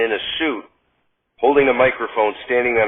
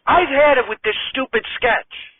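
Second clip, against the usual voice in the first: put-on much higher, girlish voice, exasperated; fed-up Gilda; 11 semitones higher than usual. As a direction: storm off in a huff. Radner quits.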